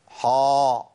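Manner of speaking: "حا" with a dark, rounded back vowel; thick, held "حا"; wrongly heavy ha. Shown as a demonstration. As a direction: swell "حا" into a heavy "haw". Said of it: "احنا قلنا حا دي مش موجوده".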